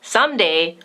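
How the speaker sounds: usual speaking voice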